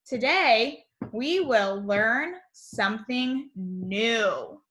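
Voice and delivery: put-on slow, careful read-aloud for little kids; reading the message. Shown as a demonstration. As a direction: read it out slowly, word by word. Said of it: "Our morning message".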